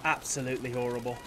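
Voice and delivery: put-on English accent. Real, not acted. Gamer bemoaning own horrid aim, English accent. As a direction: slip into a mock English accent. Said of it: "I don't know why I start speaking in, like, an English accent".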